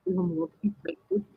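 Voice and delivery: quick, hushed and offhand, as if brushing someone off; mock sassy quote. To friends, mocking herself about the dangerous crossing, breezy. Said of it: "It's like".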